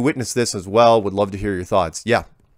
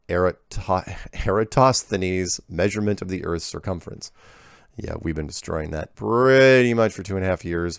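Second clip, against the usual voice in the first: ordinary delivery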